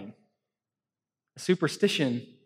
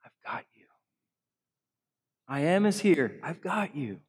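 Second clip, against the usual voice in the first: tender I've got you; 4 dB quieter than usual. Retold or imitated. Mayor hearing Jesus catch him, hushed and tender. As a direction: hold them close with your voice. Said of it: "I'm hearing Jesus say to me".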